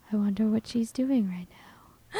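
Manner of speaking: eerie hushed whisper. Pretend. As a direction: whisper it like a bad omen. Ominous joke about the mother.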